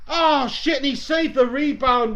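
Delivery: high, loud groan at a miss; agitated reaction. Real, not acted. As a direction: exclaim over the saved rebound. Chance just missed.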